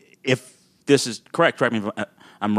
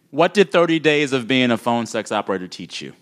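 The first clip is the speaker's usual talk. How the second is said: normal talking voice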